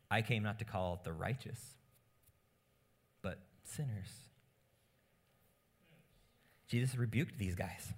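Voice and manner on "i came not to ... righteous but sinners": quiet reading. Shown as a demonstration. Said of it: "reads verse quietly".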